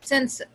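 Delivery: सेंसर with a short uh ending; सेंसर, second vowel reduced; quick schwa. Shown as a demonstration. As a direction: say सेंसर as SEN-suh. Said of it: "बहुत ही quick श्वा sound है".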